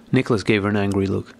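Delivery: plain reading voice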